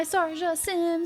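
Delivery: normal talking voice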